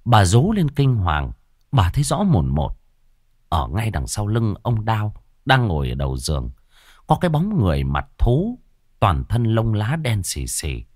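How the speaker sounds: ordinary delivery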